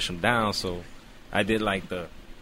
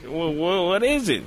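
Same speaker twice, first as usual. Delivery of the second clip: anxious question; 8 semitones higher, 4 dB louder than usual. Retold or imitated. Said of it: "I'm like".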